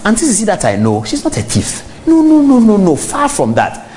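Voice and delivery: mock indignant denial. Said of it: "and says that".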